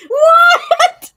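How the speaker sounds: very high, loud shriek of disbelief; astonished, laughing scream. Real, not acted. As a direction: shriek it through real laughter. Cracking up in disbelief.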